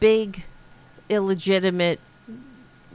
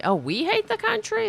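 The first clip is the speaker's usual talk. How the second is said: incredulous. Real, not acted. Mocking the claim in disbelief.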